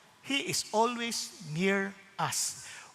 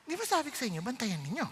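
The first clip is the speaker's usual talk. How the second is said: hushed scolding; 8 dB quieter, faster than usual. Retold or imitated. Host scolds quietly after pastor arrives.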